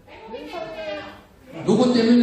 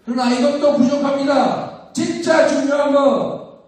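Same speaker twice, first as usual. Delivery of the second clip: roaring rallying speech; 4 semitones higher than usual. Real, not acted.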